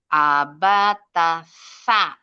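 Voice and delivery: ABATA SA chanted slowly and loudly, SA as "tha"; huruf tsa. Shown as a demonstration. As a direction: recite ABATA SA letter by letter with th. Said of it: "Huruf sa". (ABATA SA, "sa" dengan ujung lidah keluar)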